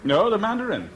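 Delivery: sharp high insistence on the hotel name; emphatic insistence. Acted. Guest insisting to the hotel clerk, emphatic. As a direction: correct the clerk firmly.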